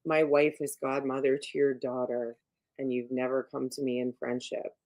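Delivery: imitating an unruffled, low-pitched godfather; extremely calm. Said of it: "he's extremely calm".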